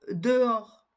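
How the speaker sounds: dehors with no H between the vowels; dehors, clearly shown with silent H. Shown as a demonstration. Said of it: "elle ne se prononce pas".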